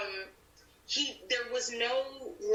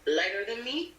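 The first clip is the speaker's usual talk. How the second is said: Latin accent. Shown as a demonstration. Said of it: "do it in the Spanish accent".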